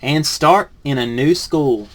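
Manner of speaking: lively picture-book read-aloud; reading to children. Acted. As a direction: read it to a class of kids.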